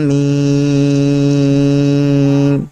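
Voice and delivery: Quranic letters drawn out very slowly; tajweed recitation with long madd. Shown as a demonstration. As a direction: chant each letter long and measured. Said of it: "इसको खींचेंगे".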